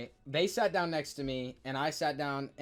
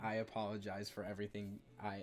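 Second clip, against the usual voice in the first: hushed apology; 7 semitones lower, 11 dB quieter, faster than usual. Retold or imitated. Apologizing to waitress.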